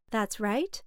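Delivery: ordinary delivery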